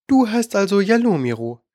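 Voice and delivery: hohe, perlende Stimme einer verspielten Königin; verführerische Königin. A narrator's reading. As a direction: neckisch und lockend fragen. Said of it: "lachte perlend". (kokette Königin, hoch und verspielt)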